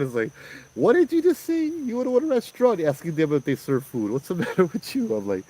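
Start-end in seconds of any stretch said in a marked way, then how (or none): 0.8-5.2 hammed-up son teasing his father; incredulous son. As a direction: scold dad for a silly question, half laughing. Voicing son's mock disbelief.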